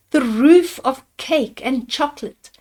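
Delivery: usual speaking voice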